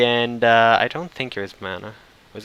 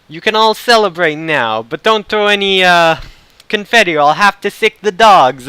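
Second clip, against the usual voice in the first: put-on loud, higher Brooklyn accent, mob-lord style; imitated Brooklyn priest; 6 semitones higher, 6 dB louder than usual. Priest warning wedding guests like a mob lord, Brooklyn accent. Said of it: "the priest had the strongest Brooklyn accent".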